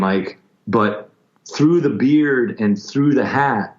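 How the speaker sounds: ordinary delivery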